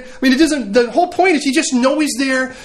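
ordinary talk, nothing marked

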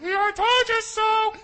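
loud, high, slow gloating call; shouted told you so. Retold or imitated. Mary calling from the other room, loud and gloating. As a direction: yell it smugly from the next room. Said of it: "Mary going from the other room".

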